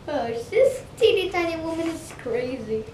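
ordinary delivery

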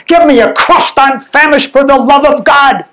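high, desperate starving cry; groaning plea. Acted. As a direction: beg for bread as if dying. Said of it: "she groaned".

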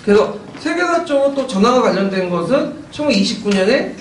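ordinary talk

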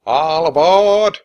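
loud, slow drawn-out call. Acted. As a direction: boom it across the river. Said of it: "one of the rowers called".

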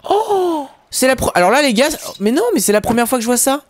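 high-pitched gasp into excited disbelief; amazed. Real, not acted. Player finding something for the first time, amazed. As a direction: gasp high, then rush out the astonishment.

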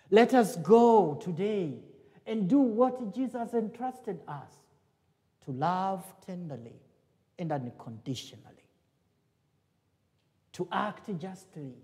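ordinary delivery

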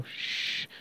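a breathy unvoiced hush to calm her; soft shush. A narrator's reading. Veer soothing crying Pakhi, whispered shush.

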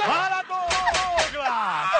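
very slow stretched greeting call. Acted. Prankster hailing a stranger, slow loud call. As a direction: draw the name out across the street.

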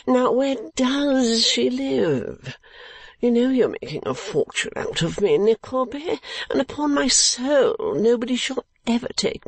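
put-on high, drawling silly young lord; foolish lordly dupe, eager. Client begging Nickleby for her address, high eager drawl. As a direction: plead in a high foppish drawl.

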